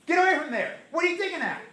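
high loud scolding; scolding the dog. Retold or imitated. Owner catching the dog digging again, a high exasperated scold. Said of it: "you're like".

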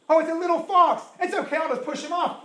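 high, loud, carefree mock voice; mock careless dismissal. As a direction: play the naive one who shrugs it off. Mocking a careless believer.

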